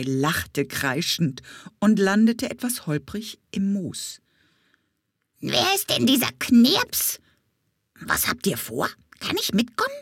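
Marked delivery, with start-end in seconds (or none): 5.4-9.9 chattery parrot voice jumping up and down; curious parrot. Acted. Parrot Camillo quizzing the bee about the boy, nosy. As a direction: pester them like a nosy bird.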